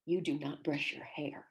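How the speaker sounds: slow, low whispered verdict, imitated; hushed disapproval. Program head reproaching her student, in a mock-grave whisper.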